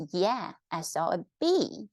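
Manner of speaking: "bee" falling firmly at the end; statement intonation. Shown as a demonstration. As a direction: drop the pitch through "bee". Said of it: "our intonation goes down".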